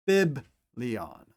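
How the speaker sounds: biblion with a clear b and stress on the first syllable; Greek word. Shown as a demonstration. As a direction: say biblion slowly for the class. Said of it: "as in".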